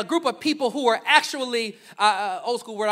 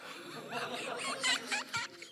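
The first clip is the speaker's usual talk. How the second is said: real laugh; 12 dB quieter than usual. Real, not acted. Laughing at own joke.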